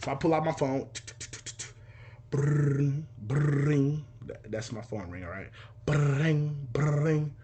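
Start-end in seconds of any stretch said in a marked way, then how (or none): 2.3-4.0 trilled, sustained ringtone noise; imitated phone ring. Caller waiting for dad to pick up, imitated ringing. 5.8-7.3 trilled, sustained ringtone noise; imitated phone ring. Caller waiting for dad to pick up, imitated ringing.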